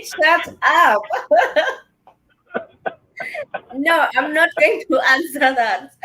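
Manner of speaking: flustered laugh through a refusal; laughing protest. Real, not acted. Host teased by guest about baby's daddy, laughing protest. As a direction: brush off the teasing while laughing.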